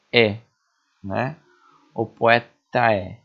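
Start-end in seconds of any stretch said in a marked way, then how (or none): 1.9-3.2 "O poeta" drawn out very slowly, "o" and "po" run together; slow linked syllables. Shown as a demonstration. Teacher showing students how vowels join in speech. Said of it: "a gente faz essa junção na sonoridade".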